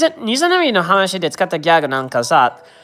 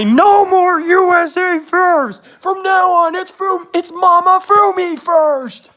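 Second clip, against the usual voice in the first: much higher, slow hammed-up proclamation; mock lovestruck Trump; 11 semitones higher, slower than usual. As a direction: declare it like a smitten president. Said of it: "possessed Trump to make him say".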